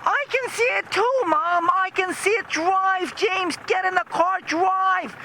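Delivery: high-pitched screaming kid impression; imitated son joining the hysteria, very high; mock panicked son. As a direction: shriek in falsetto, hammed-up panic. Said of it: "her son got in on the act".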